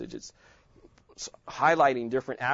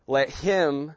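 him lifted and louder; stress on him; 4 dB louder than usual. Shown as a demonstration. Teacher stressing him for the class. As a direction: hit the word him. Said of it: "I'm emphasizing different words".

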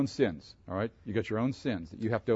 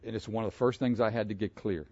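usual speaking voice

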